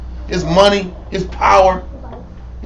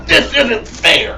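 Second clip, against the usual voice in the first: put-on pouting child; 3 semitones higher, much slower than usual. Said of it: "He's pouting his ass off".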